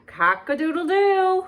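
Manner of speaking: high, loud cock-a-doodle-doo crowed like a rooster. Acted. Reading a farm book to children, crowing like a rooster. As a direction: crow it out for the kids. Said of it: "Who says cock-a-doodle-doo?".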